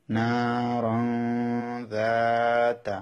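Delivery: যাতা held very long and slow; recitation demo. Shown as a demonstration. Teacher reciting an example word, drawn out. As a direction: draw out যাতা like Quran recitation. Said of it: "যেমন".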